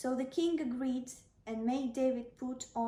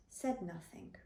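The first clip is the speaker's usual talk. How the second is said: plain reading voice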